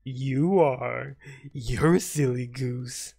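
put-on slow, loud, sing-song taunting of a toddler; drawn-out patronizing baby voice; mock baby talk. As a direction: talk down to the opponent like a scolding parent to a baby. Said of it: "Talking to me like that".

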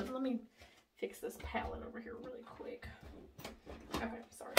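whispered under the breath while fixing the palette; a quick whispered aside while tidying up. Real, not acted. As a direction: drop to a whisper, half to herself.

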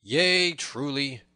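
high, loud agreement easing off; said aloud. A narrator's reading. Little John concedes to the tanner, aloud. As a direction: agree out loud for him to hear. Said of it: "the first aloud".

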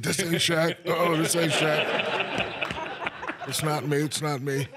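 high, loud hammed-up protest; mock denial. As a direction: drag it out high, half laughing. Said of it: "I was like".

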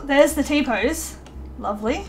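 ordinary talk

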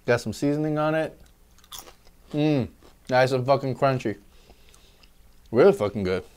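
talking between bites; chewing fries. Real, not acted. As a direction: mouth partly full. Seen 2: Fries held at mouth, bites taken and chewing throughout the labelled stretch.

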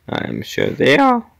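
voice crack. Real, not acted. Player agreeing the gorillas are very strong, voice cracking. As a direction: voice cracks upward on the last word. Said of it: "Oh, voice crack".

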